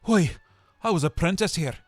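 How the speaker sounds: bursting with memory; excited Scrooge. A narrator's reading. Scrooge speaks.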